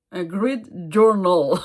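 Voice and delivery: slow, careful English accent on grid journal. Shown as a demonstration. Telling viewers the English name, in an English accent. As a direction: say it the English way, drawn out. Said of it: "avec mon accent anglais".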